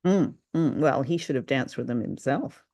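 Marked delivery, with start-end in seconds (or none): none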